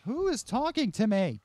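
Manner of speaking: high, loud spooked yell; startled shout. Real, not acted. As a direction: call out in alarm to an unseen voice. Startled by disembodied game voices.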